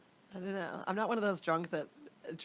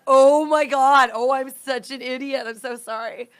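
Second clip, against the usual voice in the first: higher, much louder, slow, mortified; embarrassed realization; 5 semitones higher, 13 dB louder than usual. Retold or imitated. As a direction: cringe at your own mistake out loud. Realizing the missed interview.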